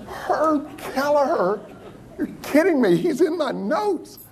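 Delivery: high-pitched incredulous exclamation; drawn-out, high mock astonishment; starstruck disbelief. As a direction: retell it as giddy, astonished recognition. Acts out his astonishment at spotting Kelleher.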